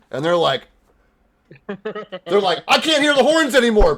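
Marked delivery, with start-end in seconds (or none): 2.7-4.0 guard voice. Acted.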